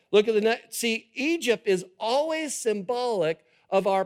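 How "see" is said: ordinary delivery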